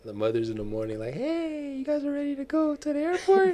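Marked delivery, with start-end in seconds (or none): 1.2-3.5 high, quick mom voice; imitated mother. Mother asks if they're ready for the airport, imitated in a high voice. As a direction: put on a softer, high-pitched mother calling out. Voicing the mother next morning.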